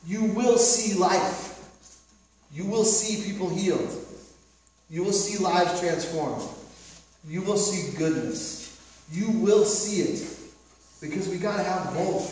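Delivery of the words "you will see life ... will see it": slow, falling preacher cadence; slow preaching build. Acted. Pastor promising the congregation, slow preaching cadence. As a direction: drive it home slowly, pulpit style.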